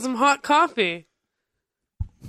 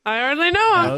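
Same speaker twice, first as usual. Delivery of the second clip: enthusiastic; 4 semitones higher than usual. Shown as a demonstration. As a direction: sell it with real eagerness. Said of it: "but I'm saying it, like, enthusiastically".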